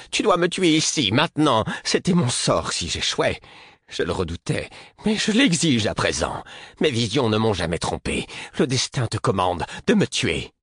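high-pitched indignant command; indignant demand. A narrator's reading. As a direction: demand your death, outraged. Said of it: "de l'indignation dans sa voix".

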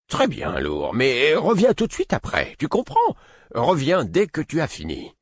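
high, impatient telling-off; annoyed. A narrator's reading. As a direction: hurry him along, irritated. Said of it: "de l'agacement dans la voix".

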